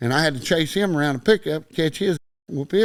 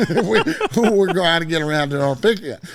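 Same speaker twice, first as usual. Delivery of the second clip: higher, louder, breaking into laughter; laughing while talking; 4 semitones higher, 5 dB louder than usual. Real, not acted. Storyteller amused by his cool fast walk, laughing. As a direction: laugh your way through the line.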